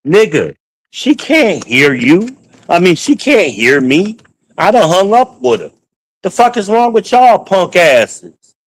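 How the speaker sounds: exasperated rant. Retold or imitated. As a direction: scold them like they're cowards. Berating installers, retold confrontation.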